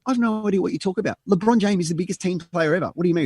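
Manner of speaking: exasperated. Retold or imitated. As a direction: protest in disbelief. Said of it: "I'm going".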